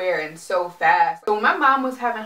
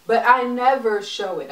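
ordinary delivery

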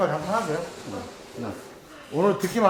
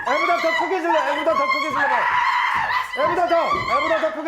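loud; 10 semitones higher, faster than usual. Real, not acted. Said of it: "coach shouting".

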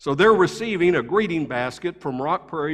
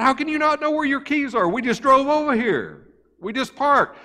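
exasperated disbelief; 9 semitones higher than usual. Retold or imitated. Exasperated at lost keys.

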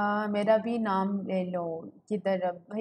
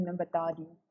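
ordinary talk

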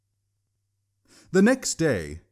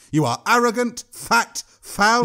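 much higher and louder, spat out one by one; angry insults; 9 semitones higher, 4 dB louder than usual. A narrator's reading. Old woman lists the troll's faults, furious. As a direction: hurl each insult.

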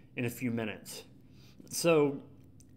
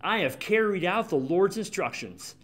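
higher, brightly falsely cheerful Saul; eager Saul; 6 semitones higher, 3 dB louder than usual. Retold or imitated. Saul innocently greeting the prophet Samuel, mock cheerful. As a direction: greet him with an innocent show of piety. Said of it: "Saul said".

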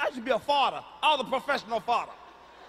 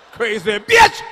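much higher and louder scream of an insult; furious yell; 11 semitones higher, 12 dB louder, slower than usual. Retold or imitated. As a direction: blast it at her. Yelling at sister.

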